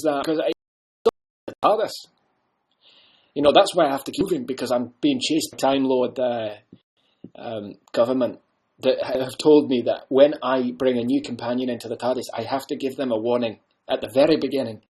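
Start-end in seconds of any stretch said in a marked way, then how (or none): none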